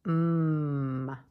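long humming "mm"; m sound. Shown as a demonstration. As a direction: hum "mm" with lips closed. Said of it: "both start with".

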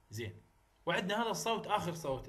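ordinary talk, nothing marked

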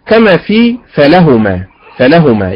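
ordinary delivery

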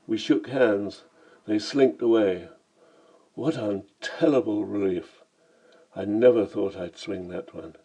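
plain reading voice

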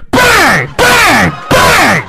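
loud high rhythmic shouts; pounding shouts. Retold or imitated. Woman backing into him hard shouts each bang loudly. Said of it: "She's going like".

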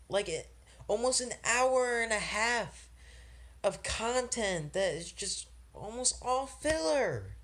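high, strained, whiny complaining; real upset rant. Real, not acted. Reviewer ranting to viewers about [name]'s album, upset high whine. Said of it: "why I'm getting upset like this".

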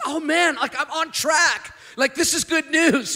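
high and loud, slowed, gleeful; thrilled. Retold or imitated. Young man thrilled at hearing the commandments, high and loud. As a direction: sound like you just aced the test. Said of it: "he's like".

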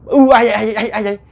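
high, loud panicked stammer; startled cry. Acted. Startled woman asks the shadow who is there, panicked high cry. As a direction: stutter it out high in sudden fright.

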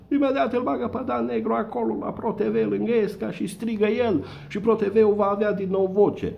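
very high, loud hammed-up show host yelling; mock announcer shout. Satirist mimics a TV host yelling, hammed-up and very high. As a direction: belt it out like a TV host hyping the crowd.

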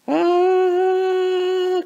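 a long, very high excited scream going down the hill; roller coaster scream. Shown as a demonstration. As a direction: let out a thrilled coaster-ride squeal.

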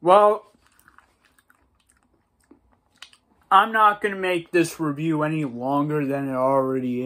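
talking with food in mouth; muffled chewing between words; chewing while talking. Real, not acted. Seen 0: Ate spoonful earlier; lips pursed, chewing motions between words behind bowl.

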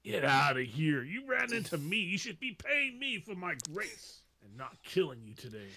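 put-on pompous Southern accent. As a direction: scold like an affronted Southern gentleman. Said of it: "I've totally stolen Vince's accent now".

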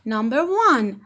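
ordinary talk

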